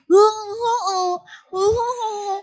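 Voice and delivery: high, strangled mute oh sounds; mute grunting. Acted. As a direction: try to speak but only grunt oh. Mute Zakaria again.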